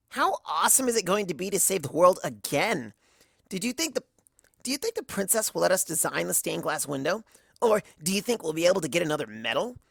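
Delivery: fed-up, pleading for an end; Rainbow Dash. A narrator's reading.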